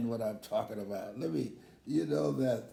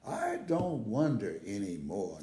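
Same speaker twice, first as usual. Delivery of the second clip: usual speaking voice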